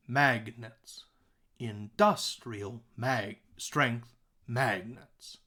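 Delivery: "Industrial strength magnets" very slowly, pausing between words; broken-down stresses. Shown as a demonstration. Teacher breaking the line down for students, slow stressed beats. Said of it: "I've broken it down for you".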